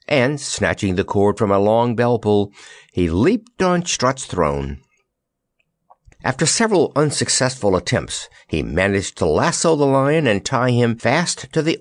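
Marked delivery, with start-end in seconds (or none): none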